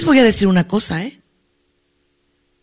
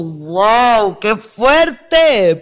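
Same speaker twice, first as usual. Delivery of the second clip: amazed reaction; much slower than usual. Acted. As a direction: stretch it out in played-up astonishment. Reacciona asombrada a la historia. (asombro exagerado y alargado)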